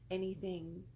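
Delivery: plain reading voice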